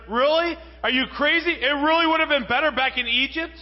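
full-force preacher scolding the Israelites; incredulous preaching. Acted. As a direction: hammer each question at the crowd. Preacher confronts listeners incredulously.

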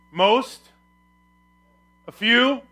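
MOST and FEW high, loud and rising; rhetorical questions. Shown as a demonstration. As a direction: toss out "Most?" and "A few?" as doubtful guesses. Offers wrong words as questions.

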